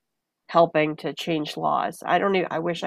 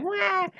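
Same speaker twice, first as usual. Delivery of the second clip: much higher mock newborn wail; imitated baby cry; 13 semitones higher, 4 dB quieter than usual. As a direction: squeak out a baby's cry. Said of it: "he's crying".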